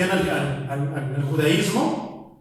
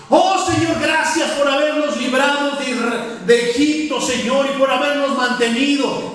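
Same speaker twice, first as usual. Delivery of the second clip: fervent prayer; 6 semitones higher than usual. Retold or imitated. A worshipper in a gringo church thanks God, fervent and high. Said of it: "iglesia de, de puros gringos".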